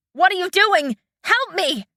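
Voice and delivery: high, frantic cry for help; panicked scream. Acted. Fen screams at Shang, caught in vines, panicked. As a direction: scream at him in terror. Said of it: "Fen screamed at him".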